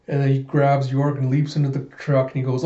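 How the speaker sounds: plain reading voice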